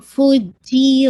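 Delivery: very slow فو جی with a firm جی; careful slow demo. Shown as a demonstration. Teacher showing correct جیم articulation. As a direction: press the جی without any air. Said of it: "جی یہ sound نہیں آنا چاہیے".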